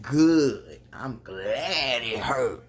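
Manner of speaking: slow, loud, menacing gloat; evil gloat. Retold or imitated. Vlogger voices the brother's cold gloat, slow menacing voice. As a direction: savour it like a villain. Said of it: "He look like".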